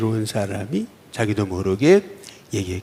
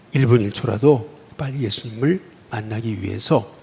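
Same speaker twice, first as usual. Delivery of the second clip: ordinary delivery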